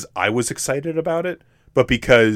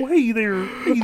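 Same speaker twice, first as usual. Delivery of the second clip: goofy voice; 12 semitones higher than usual. Pretend. Keeps teasing in goofy voice.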